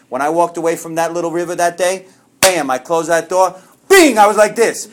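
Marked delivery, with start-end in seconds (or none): 3.9-4.2 high, loud "bing!"; sudden ringing exclamation; imitated bing. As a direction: punch out a bright sound effect. Imitated noise of sudden release.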